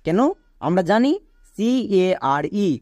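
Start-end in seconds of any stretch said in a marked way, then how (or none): 1.5-2.7 very slow letter-by-letter spelling; C-A-R-E spelled letter by letter, drawn out. Shown as a demonstration. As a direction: spell each letter out slowly. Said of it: "এর উচ্চারণ হয়".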